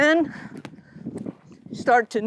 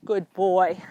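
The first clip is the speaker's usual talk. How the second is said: praising horse; 6 semitones lower than usual. Real, not acted.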